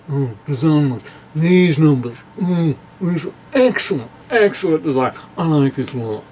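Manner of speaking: a lightly voiced connoisseur savouring the design; mock admiring critic. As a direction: purr over it like an art critic, only a touch put on.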